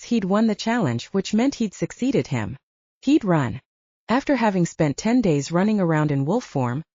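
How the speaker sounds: ordinary delivery, nothing marked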